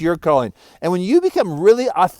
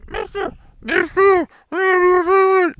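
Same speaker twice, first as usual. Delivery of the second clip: tiny frog voice; slower than usual. Acted. Frog calls from the old man's pocket in a high tiny voice. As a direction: pipe up small and high, puzzled.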